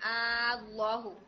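अल्लाहु recited slowly with a drawn-out first vowel; elongated madd recitation. Shown as a demonstration. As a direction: hold the opening आ of अल्लाहु long before the doubled ल. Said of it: "यहाँ पर भी मद लाजिम कलमी".

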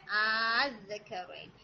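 आज़करैन with a drawn-out first vowel, recited slowly; elongated madd recitation. Shown as a demonstration. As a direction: hold the opening आ of आज़करैन very long. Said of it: "six हरकत मद लाजिम के according".

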